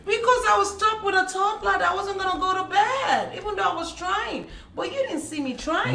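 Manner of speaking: high-pitched, exasperated protest; exasperated defence. Real, not acted. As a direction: plead your case to your partner. Defending herself in playful argument.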